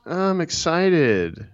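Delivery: high-pitched, dragged-out mock excitement; put-on Kardashian voice, stretched out. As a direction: imitate a reality-star drawl, slow and high. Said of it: "Just kind of this, like, Kardashian".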